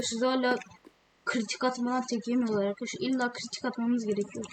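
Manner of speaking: normal talking voice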